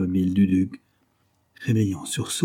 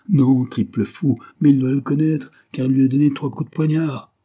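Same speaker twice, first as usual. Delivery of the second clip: raised, heated retort; agitated, higher-pitched explanation; exasperated scolding; 5 semitones higher, 5 dB louder than usual. A narrator's reading. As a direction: scold him for getting it wrong. Majordomo scolds the obtuse valet.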